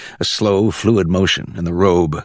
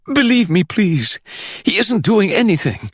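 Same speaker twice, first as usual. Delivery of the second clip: much higher, pleading insistence; 14 semitones higher than usual. A narrator's reading. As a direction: beg her to believe you. Hector begs Kathy to believe.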